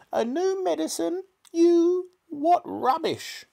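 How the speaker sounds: scornful, piercing high granny yell. A narrator's reading. Grandma scorning George's medicine, yelling. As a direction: squawk it with drawn-out disbelief. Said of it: "she yelled".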